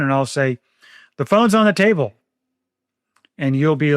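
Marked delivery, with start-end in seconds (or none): 1.2-2.1 calling out the answer. Retold or imitated. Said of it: "Why are you yelling at me?".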